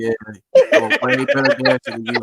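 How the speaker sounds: laughing. Real, not acted. Teasing co-host about dancing.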